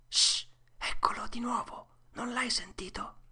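high, breathy alarmed whisper; urgent whisper. A narrator's reading. Tom alerts Huck to a noise, urgent whisper. Boys hear noises in graveyard.

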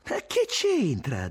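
high, drawn-out indignant question; vibrant retort. A narrator's reading. Irene objects to Tommaso's accusation, voice vibrant. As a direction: answer back slowly with charged intensity. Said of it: "disse vibrante Irene".

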